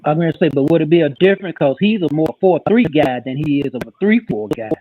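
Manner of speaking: usual speaking voice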